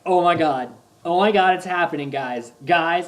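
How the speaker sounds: loud, excited fanboy outburst; thrilled retold exclamations; freaking out. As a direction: freak out at the screen, loudly. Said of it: "I freak out".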